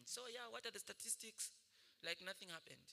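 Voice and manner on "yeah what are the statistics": ordinary talk, nothing marked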